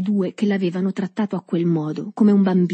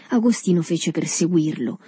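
ordinary delivery

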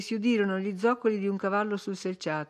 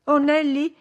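high, loud petulant cry; peevish outburst; 9 semitones higher, 8 dB louder than usual. A narrator's reading. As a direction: whine at the maid, pitched right up. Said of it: "aggiunse poi con petulanza".

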